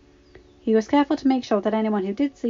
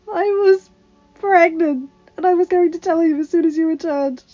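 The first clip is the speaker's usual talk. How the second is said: much higher, louder tearful confession; sobbing; 10 semitones higher, 9 dB louder, slower than usual. A narrator's reading. Padme tells her husband of the miscarriage, sobbing. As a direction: break down while telling him. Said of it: "she sobbed".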